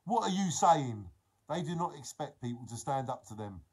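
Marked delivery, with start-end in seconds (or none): 0.1-1.1 loud, higher "oi" challenge; confrontational challenge. Acted. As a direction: call them out loudly, acted. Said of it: "confront them and say".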